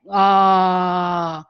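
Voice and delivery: loud, open ah on one breath; open vocal exercise sound. Shown as a demonstration. Teacher demonstrating a nasality exercise to students, loud open ah. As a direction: belt out a vocal warm-up ah. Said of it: "with your lips formed like you're making a U".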